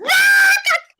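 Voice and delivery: loud, high shriek of laughter; screaming laugh. Real, not acted. Woman cracked up by her friend's remark, screaming with laughter. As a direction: burst out in a real, piercing laugh-scream. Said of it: "I didn't mean to scream out loud".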